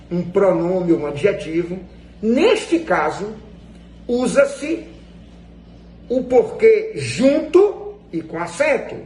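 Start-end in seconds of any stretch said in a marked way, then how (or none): none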